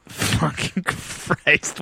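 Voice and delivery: slow whispered groan of frustration; exasperated whisper. Retold or imitated. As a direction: mutter it fed up and slow. Exasperated during endless photos.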